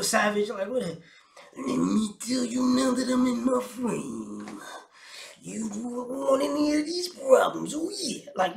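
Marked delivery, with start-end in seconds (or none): 1.6-8.3 higher, slow, swaggering wrestler promo. Retold or imitated. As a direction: ham it up like a pro-wrestling trash talker. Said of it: "show up as Ric Flair".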